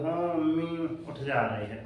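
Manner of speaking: soft, slow, sweet agreement; lovingly. Retold or imitated. Child sweetly answers his mother's call, soft and tender. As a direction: answer mom gently, full of affection. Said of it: "आप भी बड़े प्यार से उठ जाओगे".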